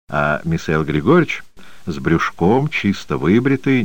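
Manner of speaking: usual speaking voice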